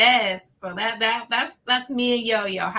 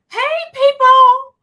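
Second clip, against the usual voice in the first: high, loud, cheery call to the room; imitating her friend's sunny hello; bubbly greeting; 14 semitones higher, 11 dB louder, slower than usual. Friend greets coworkers, mimicked in a high bright hello. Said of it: "She's like".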